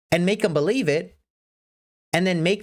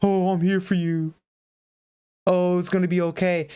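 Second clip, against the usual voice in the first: mocking comfort voice; 2 semitones higher than usual. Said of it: "You're not asking for some B S".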